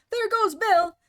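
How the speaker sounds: high, loud cry; shout. A narrator's reading. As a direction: shout it out together. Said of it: "everyone shout".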